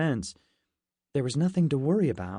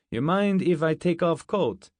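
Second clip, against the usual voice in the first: put-on higher Russian-accented request; thick Russian accent; 5 semitones higher, 4 dB louder than usual. A narrator's reading. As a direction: ask casually in a heavy Russian accent. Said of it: "He had a thick Russian accent".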